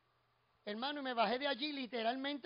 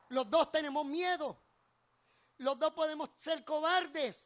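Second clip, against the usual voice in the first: slow, emphatic preaching delivery; 3 semitones higher, 5 dB louder, slower than usual. Real, not acted. Preacher tells the congregation of two kinds of cowards, emphatic preaching. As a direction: stretch the words out and drive them home.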